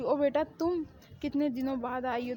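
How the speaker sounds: ordinary talk, nothing marked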